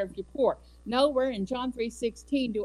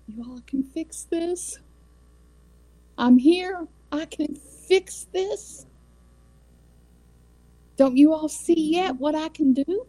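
much higher, slow and strained pleading; God pleading; 9 semitones higher, 4 dB louder than usual. Pretend. Preacher voicing God longing for people to trust him, pleading. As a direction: beg them to see what you can do.

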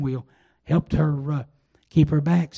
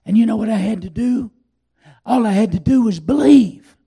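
loud, higher preaching build; fervent preaching; 5 semitones higher, 7 dB louder than usual. Acted. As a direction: drive the point home to the congregation.

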